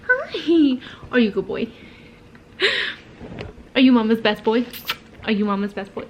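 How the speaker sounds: sweet doggy voice; talking to a dog. Real, not acted. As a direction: coo at the puppy.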